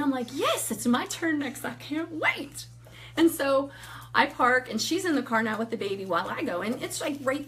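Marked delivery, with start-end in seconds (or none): 0.3-2.7 high-pitched, drawn-out eager glee; a touch of giddy anticipation; eager excitement. Retold or imitated. Awaiting her turn giving Bibles, mildly giddy.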